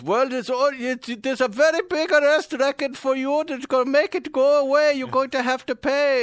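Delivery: high-pitched mock Middle Eastern scam boss; angry Middle Eastern accent. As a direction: lay down the threat in a put-on Middle Eastern accent. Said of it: "angry Middle Eastern guys".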